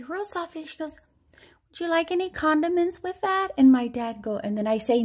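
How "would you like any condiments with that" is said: soft-spoken cashier. Retold or imitated. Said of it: "the lady says real softly".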